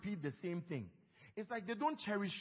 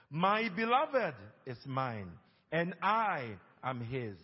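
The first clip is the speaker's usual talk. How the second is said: loud, slow Bible reading; scripture reading; 8 dB louder, slower than usual. Acted. Pastor leads the church reading Song of Solomon, loud and slow. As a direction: read the verses aloud for all to follow. Reads scripture aloud together.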